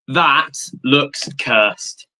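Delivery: normal talking voice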